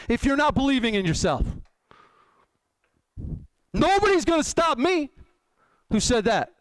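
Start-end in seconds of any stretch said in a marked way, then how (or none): 3.7-5.1 brash boxer boast; taunting fighter bravado; Muhammad Ali. Retold or imitated. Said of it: "Muhammad Ali".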